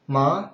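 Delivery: MA rising like a question; second tone. Shown as a demonstration. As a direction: lift the pitch through MA.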